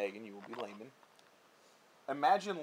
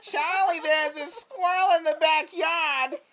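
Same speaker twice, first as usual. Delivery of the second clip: put-on much higher, louder, slow sing-song old lady; grandma voice; 11 dB louder, slower than usual. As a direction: coax him about the squirrel like grandma. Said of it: "what she actually sounds like".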